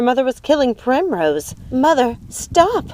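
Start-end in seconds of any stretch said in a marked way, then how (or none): 1.7-2.8 high, stretched cry to stop; restrained scream, pitched high; screamed plea. A narrator's reading. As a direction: cry out to mother, drawn out. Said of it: "screamed Hazel".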